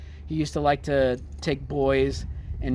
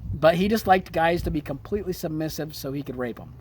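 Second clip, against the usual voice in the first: ordinary talk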